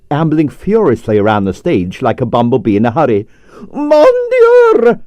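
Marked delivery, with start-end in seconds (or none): 3.7-5.0 very high, loud shriek of alarm; shrill Mon Dieu. Acted. Ballerina shrieks at seeing her husband on stage, very high and loud. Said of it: "Arienska's shrill voice".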